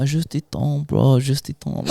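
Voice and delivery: imitating laid-back French speakers; full French. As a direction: put on a French accent, unhurried. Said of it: "like, full French".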